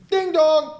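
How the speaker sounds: high, singsong notification sound; mimicked phone chime. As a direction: imitate a ringing alert, high-pitched. Imitates phone of bored moviegoer.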